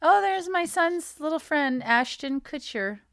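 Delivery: lightly voiced parent spotting a child; preschool parent. Parent pointing out son's little friend, light parent voice.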